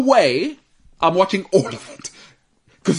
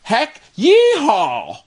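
high, drawn-out American yeehaw; mock American accent, stretched out wide; hammed-up American cowboy twang; 6 semitones higher, much slower than usual. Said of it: "saying every American sounds like".

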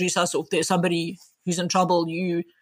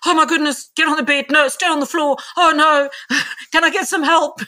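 high, loud flustered panic; mock panic, higher and louder; 8 semitones higher, 5 dB louder than usual. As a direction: play a doctor losing it over a collapsing actor. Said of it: "they're like".

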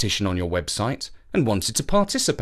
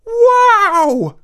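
much higher, loud astonished cry; amazed wow; 9 dB louder than usual. A narrator's reading. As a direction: gasp out the wonder of it. Children see the ice castle.

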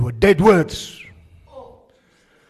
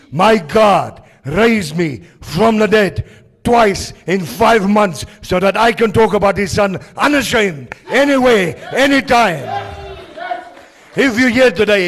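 full-force preaching; 9 semitones higher, 7 dB louder than usual. Acted. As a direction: preach it out to the hall. Preaching climax.

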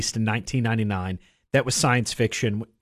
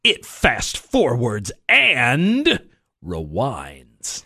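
mock promo voice; slower than usual. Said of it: "that's like going".

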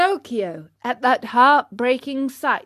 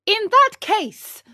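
high, loud puppet declaration; piercing, proud little voice; 11 semitones higher, 6 dB louder than usual. Acted. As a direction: announce it proudly, high and slow. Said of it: "said Pinocchio proudly".

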